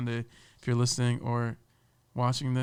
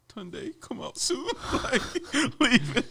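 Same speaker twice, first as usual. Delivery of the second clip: mock high plea; 12 semitones higher, slower than usual.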